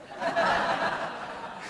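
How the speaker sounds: high breathy burst of laughter; airy laugh breaks out. Real, not acted. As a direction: laugh out at the punchline. Laughing at brother's reason.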